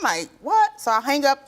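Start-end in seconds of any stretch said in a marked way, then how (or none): 0.4-0.8 shocked what. Retold or imitated. Retold shocked reply to daughter.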